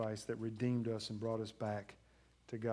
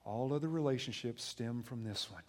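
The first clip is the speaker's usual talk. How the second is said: normal talking voice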